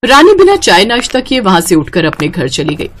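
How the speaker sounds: ordinary delivery, nothing marked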